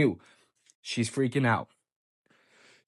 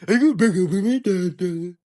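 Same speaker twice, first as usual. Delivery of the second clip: mocking imitation; 2 semitones higher, 5 dB louder than usual. Boyfriend mocks girlfriend's dumbass question, mocking mimicry. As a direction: parrot her question as mockery, trailing into syllables. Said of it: "why is she asking this dumbass shit".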